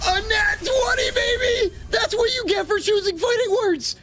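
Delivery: high-pitched victory yell; screaming celebration, way up high; triumphant shout. Real, not acted. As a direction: crow over a winning roll at full pitch. Celebrates rolling a natural twenty.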